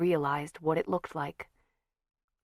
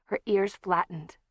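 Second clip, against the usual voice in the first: plain reading voice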